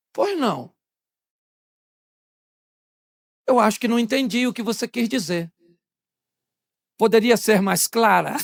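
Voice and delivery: put-on courteous husband; polite courtesy. Husband to his wife in front of others, politely. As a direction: ask with exaggerated good manners.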